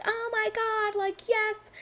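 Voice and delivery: high, softened squeal; imitated thrilled crowd; mock fangirl gushing. Acts out adoring crowd.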